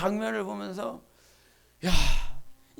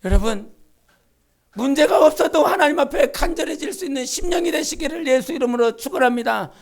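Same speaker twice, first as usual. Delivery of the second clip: ordinary talk, nothing marked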